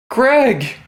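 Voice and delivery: loud, high delighted shout of a name; joyful greeting. Acted. Rogue greeting the speaker like an old friend, loud and delighted. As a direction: greet him like a long-lost friend. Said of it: "throw my arms open and say".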